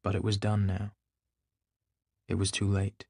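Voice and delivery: plain reading voice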